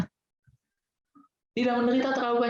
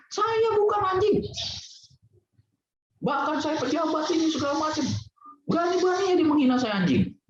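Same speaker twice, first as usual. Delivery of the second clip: high-pitched indignant whine of a proud man insulted; offended official; 8 semitones higher than usual. Retold or imitated. Speaker mimicking an insulted official, hammed-up indignant rant. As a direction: play the pompous official outraged at being called a dog.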